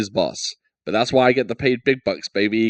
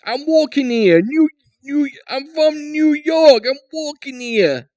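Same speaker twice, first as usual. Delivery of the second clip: shouted cartoon New Yorker, much higher and louder; New York accent; 5 dB louder than usual. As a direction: yell it like a cabbie in traffic. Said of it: "Pitch perfect".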